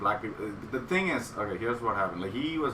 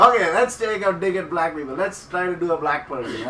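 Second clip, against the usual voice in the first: loud, higher-pitched stage announcement; raised stage voice; 6 semitones higher, 6 dB louder than usual. Retold or imitated. Comic announcing his next bit to the audience, loud. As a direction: project it to the crowd.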